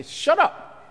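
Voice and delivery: high, loud scolding; telling him to shut up. Acted. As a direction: crowd hushing the beggar loudly. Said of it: "They tell him to shut up".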